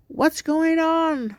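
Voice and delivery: high, loud, slow cry of alarm; panicked yell. A narrator's reading. A scared mom shouting to her screaming children. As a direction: shout it out in sudden fear. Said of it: "I scream".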